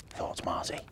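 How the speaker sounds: plain reading voice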